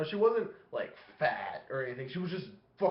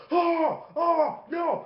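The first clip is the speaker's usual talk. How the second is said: high, loud mock cry of horror; horrified recoil; 13 semitones higher, 7 dB louder, much slower than usual. Dad hit by a stench, horrified mock yell. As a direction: recoil loudly, pitched way up.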